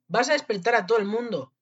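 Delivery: high, loud reproach; scolding. A narrator's reading. Wolf to the noisy boy inside him, scolding. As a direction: complain loudly about the racket.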